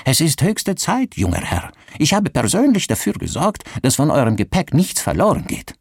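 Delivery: high-pitched, eager innkeeper voice; beflissener Wirt. Acted. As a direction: play an obliging innkeeper, pitched well up. Innkeeper's voice, hoping for a tip. (dienstbeflissene, hohe Wirtsstimme)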